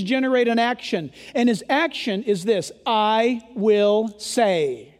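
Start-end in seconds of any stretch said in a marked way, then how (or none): 2.8-4.9 "I will say" stretched very slowly; drawn-out emphasis. Shown as a demonstration. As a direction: drag out "I will say" word by word. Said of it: "That is very, very important".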